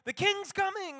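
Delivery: high, loud call from the wall; watchman's announcement. Acted. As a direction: shout it out like a lookout. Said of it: "A watchman would be like".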